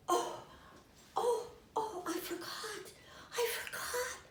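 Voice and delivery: high, soft, tearful panic; imitating a flustered starlet fretting; panicky. Retelling Marilyn fretting, high tearful panic.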